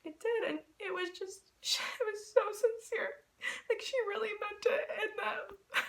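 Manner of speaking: high, soft, close to tears; choked up. Real, not acted. Fan recalling Taylor's sincere compliment, choked up. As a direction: let the voice go high and small, holding back tears.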